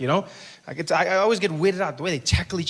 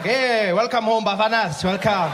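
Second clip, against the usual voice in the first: cheerful welcome; 4 semitones higher than usual. Retold or imitated. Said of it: "We're just there, like".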